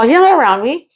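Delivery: loud high protest. Retold or imitated. Arguing with PO.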